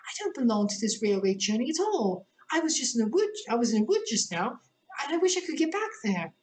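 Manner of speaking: put-on the girl wants to go home; Alice voice. A narrator's reading. Alice protesting to the gentleman, high and plaintive.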